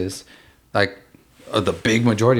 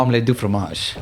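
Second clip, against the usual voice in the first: put-on French accent. Dexter's Lab French quote.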